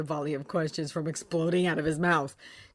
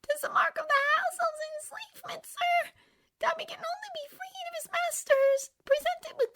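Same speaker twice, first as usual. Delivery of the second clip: very high, whispery house-elf squeak; a tiny frantic elf pleading; Dobby voice. A narrator's reading. Tearful house elf begging Harry Potter, high and whispery.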